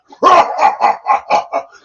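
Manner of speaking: high, loud villain cackle; hammed-up raspy monster laugh; evil laugh. Mock evil laugh after menace.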